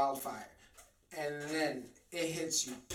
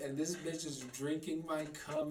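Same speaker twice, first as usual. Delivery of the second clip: ordinary delivery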